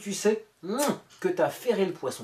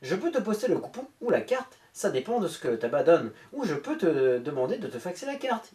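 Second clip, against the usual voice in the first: ordinary delivery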